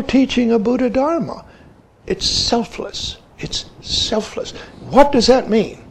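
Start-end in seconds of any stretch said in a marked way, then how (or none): 2.1-3.2 breathy hush with the weight on "It's", not on "selfless"; whispered. Shown as a demonstration. Key point, hushed. 3.4-4.5 even softer hush, the weight on "selfless"; whispered. Shown as a demonstration. Key point, hushed.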